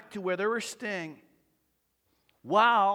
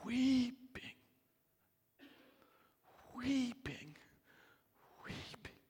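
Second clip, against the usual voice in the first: mournful weeping; 5 semitones higher, 9 dB quieter than usual. Shown as a demonstration. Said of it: "This was weeping".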